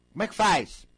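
loud high "como é que faz"; exasperated cry. Acted. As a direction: throw up the question in loud dismay. Imitando pessoa aflita. (pergunta aflita e alta)

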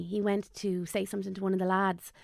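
ordinary talk, nothing marked

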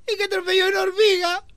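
imitating the crying boy. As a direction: whimper it like a small boy. (imitando al niño llorando)

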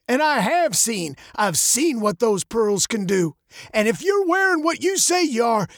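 put-on high, gravelly old man's voice. A narrator's reading. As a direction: warn like a testy old man, high and gravelly. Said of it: "his voice as old and gravelly as his face".

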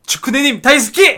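gushing. Acted. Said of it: "gushing fan shout-out".

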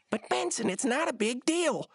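high put-on protesting voice; Rigby. Slacker arguing with the boss, high mock protest. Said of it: "then Rigby says".

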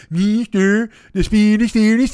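a hint of the Swedish cook puppet; mock puppet-chef babble. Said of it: "talk like the Swedish Chef".

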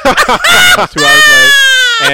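high, loud laughing confession, drawn out; real helpless laughter. Real, not acted. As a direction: laugh hard through the words. Laughing at own lateness confession.

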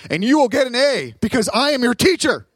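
angry professor. Acted. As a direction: lay down the law to the class. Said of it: "the professor's like".

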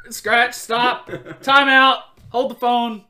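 loud, high comic brakes; loud and high, like stopping everything; mock halt. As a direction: slam on the brakes, playfully shouted. Comic halt after the kid speaks.

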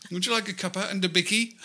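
quick mock British accent; a light British accent, playfully echoing. Friend echoing the British tea offer, lightly accented. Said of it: "do a British accent".

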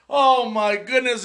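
ordinary talk

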